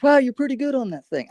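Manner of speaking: a hint of an amazed park-bench guy; friendly stranger's voice. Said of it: "he goes".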